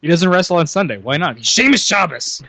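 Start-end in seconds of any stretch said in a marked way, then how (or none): 1.4-2.4 punchline delivery. Pretend. Joke punchline repeated.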